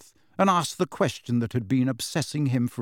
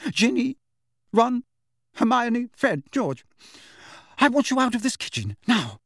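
high, urgent maternal command; stern mother's order; 10 semitones higher than usual. A narrator's reading. Mother ordering the children out of the kitchen, stern and high. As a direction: call out each name sharply, then give the order.

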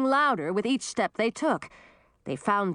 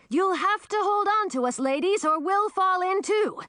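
high-pitched urgent warning; yelling; 11 semitones higher than usual. A narrator's reading. Man at the hole's edge to the women, yelling. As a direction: yell a warning to hold tight. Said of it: "yelled Edric".

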